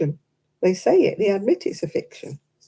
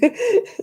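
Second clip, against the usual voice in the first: high laugh. Real, not acted. Laughs at own slip.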